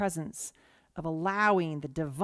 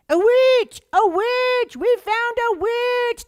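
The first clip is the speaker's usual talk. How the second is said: shrieked much higher and louder, last line slow; Monty Python villager shout; 12 dB louder, much slower than usual. Retold or imitated. Villagers yelling at the crowd, hammed-up high shout. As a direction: scream it like a mob that caught a witch. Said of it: "I'm gonna just act it out".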